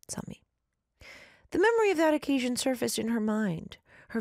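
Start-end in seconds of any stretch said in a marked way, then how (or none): 0.1-0.4 muttered name, very quiet; under breath. Shown as a demonstration. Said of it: "saying an artist name under my breath".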